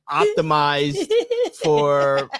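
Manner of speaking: drawn out slowly for laughs; milk each word, slow; slow punchline. Acted. Joking about the carpet man, slow deadpan.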